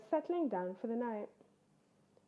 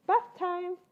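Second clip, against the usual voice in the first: higher, brighter little bear; higher, louder little cub; small bear voice; 8 semitones higher, 5 dB louder than usual. Acted.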